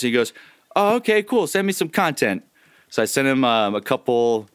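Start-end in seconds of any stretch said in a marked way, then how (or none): 0.8-2.4 high-pitched friend imitation; Zen. Voice actor retelling his recruiter's reply, friend impression. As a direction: jump up high to imitate him. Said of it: "It's a good Zen impression".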